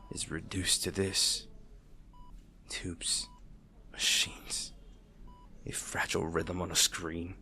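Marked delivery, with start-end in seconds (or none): 2.7-7.3 whisper. Acted. Listing hospital machines.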